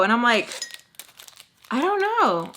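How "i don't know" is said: high-pitched, clueless retort; mock bewilderment, pitched way up; dumbfounded. Sleepy guest who has no idea what she was told, dumbfounded. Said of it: "I'm so dumbfounded".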